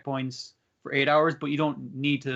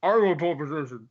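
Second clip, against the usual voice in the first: garbled words round a big bite; mouth full; 3 dB louder than usual. Retold or imitated. Parry pops up on stage mid-meal, mouth full. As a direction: mumble it through a mouthful of food.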